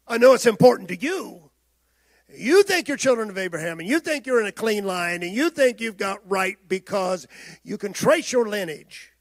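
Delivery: preaching to the congregation. Acted. Preacher challenging proud listeners with a rising voice. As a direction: press the point home to the congregation.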